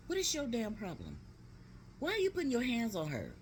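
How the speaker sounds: high-pitched outraged demand; indignant confrontation. Retold or imitated. Aunt confronting uncle for touching her niece, indignant. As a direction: retell it as an outraged challenge.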